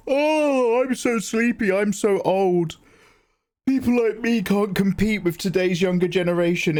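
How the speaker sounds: hammed-up sleepy old man whine; whiny tired oldie. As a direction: moan like a worn-out has-been in a commercial.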